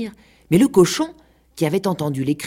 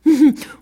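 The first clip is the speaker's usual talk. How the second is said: high little contained chuckle; discreet laugh; 11 semitones higher, 7 dB louder than usual. A narrator's reading. Peacock pausing to mock the quarrellers, discreet high titter. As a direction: titter to yourself, politely. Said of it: "pour rire discrètement".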